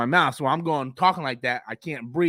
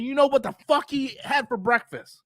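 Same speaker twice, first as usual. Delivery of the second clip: usual speaking voice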